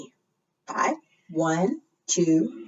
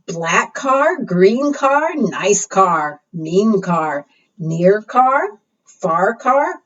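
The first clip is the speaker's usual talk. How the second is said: rhyming read-aloud; 5 dB louder, much slower than usual. Acted. Reading rhyming car book aloud.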